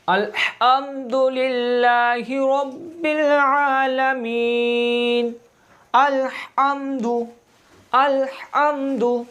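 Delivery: अल्हम्दु recited slowly with a throaty ह; slow recitation with strong ha. Shown as a demonstration. Teacher reciting Fatiha slowly to show the ha. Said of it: "क्या हा है?".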